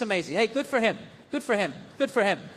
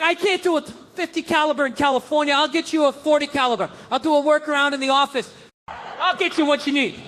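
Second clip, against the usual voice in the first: high-pitched, insistent hollering; mock shouting dealer; 7 semitones higher than usual. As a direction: holler the sales promise in a hammed-up high voice. Arms dealer in acted phone exchange.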